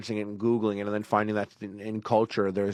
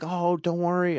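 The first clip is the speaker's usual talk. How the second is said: higher, breezy mock comfort; put-on reassurance; 7 semitones higher than usual. Man hiding his fear from girlfriend, put-on reassurance. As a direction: brush the fear away, higher-pitched.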